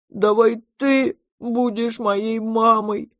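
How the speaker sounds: high, slow pleading little-one voice; childish, drawn-out proposal; childlike monster. A narrator's reading. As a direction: plead like an oversized baby. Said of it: "неожиданно предложило чудовище".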